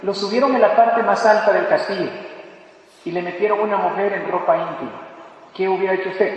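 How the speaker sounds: ordinary talk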